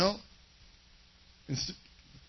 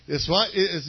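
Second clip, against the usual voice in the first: usual speaking voice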